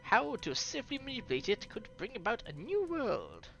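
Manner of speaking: put-on scientist explaining in a French accent. Explaining primordial ooze to listeners, French accent. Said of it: "Here's a French voice coming".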